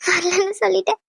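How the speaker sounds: words breaking into tears; crying. Acted. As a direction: say it through a sob. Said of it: "பைத்தியம் மாதிரி அழுதுகிட்டு இருக்க".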